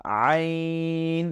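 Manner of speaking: "আইন" drawn out slowly from inside the throat; from deep in the throat. Shown as a demonstration. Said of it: "ভেতর থেকে উচ্চারণ করব".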